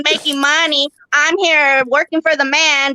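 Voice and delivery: ordinary talk, nothing marked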